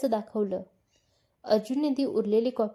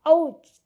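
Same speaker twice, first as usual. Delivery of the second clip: sharp yelp of pain, pitched higher and louder; a bitten hand's quick cry; sudden ouch; 6 semitones higher, 7 dB louder than usual. Acted.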